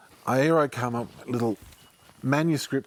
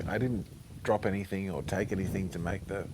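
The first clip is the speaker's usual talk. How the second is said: ordinary talk, nothing marked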